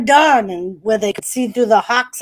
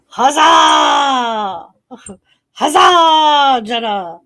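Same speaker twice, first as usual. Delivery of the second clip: cheering shout; 5 dB louder, much slower than usual. Real, not acted. Streamer cheering a chatter's correct guess, loud cry. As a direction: shout it out in triumph.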